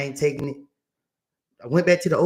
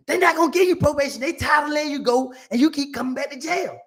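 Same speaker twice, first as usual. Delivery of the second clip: scolding lawyer; 7 semitones higher than usual. Retold or imitated. Lawyer scolds him in acted quarrel.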